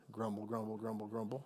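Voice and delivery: grumble, grumble said low and very soft; muttered grumble. Shown as a demonstration. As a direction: mumble grumble, grumble like a distant complaint. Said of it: "what do you hear over the side".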